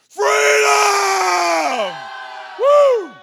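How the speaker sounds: huge high yell of freedom; full-throated shout. Real, not acted. Pastor leading the congregation's shout, full-throated yell. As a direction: cry it out with everything.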